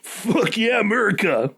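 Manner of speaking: high, hammed-up patriotic cheer; mock American cheer. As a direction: shout it like a proud American. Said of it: "And we'll say".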